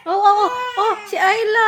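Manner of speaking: loud, high startled calls; sudden high shout of concern; alarmed calling out. Real, not acted. Mother reacting to crying baby.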